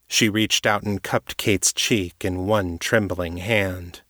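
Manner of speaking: ordinary delivery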